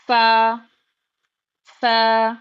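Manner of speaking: a strong clear فا; emphatic fa. Shown as a demonstration. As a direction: punch out فا. Teaching letter drill.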